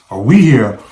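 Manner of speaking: ordinary delivery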